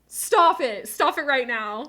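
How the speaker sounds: loud, high protest at the gift; overwhelmed squeal of protest; shocked shout. Real, not acted. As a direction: shout stop it in disbelief. Streamer shocked by big gift.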